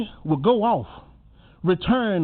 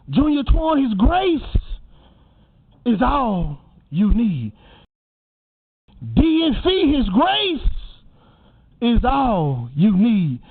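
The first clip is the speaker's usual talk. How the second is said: slow, drawn-out refrain on each closing phrase; preacher calling out each mourner, then a slow refrain; sermon refrain; 2 semitones higher, slower than usual. Acted. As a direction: lay each phrase down slowly like a blessing. Repeated refrain naming each mourner.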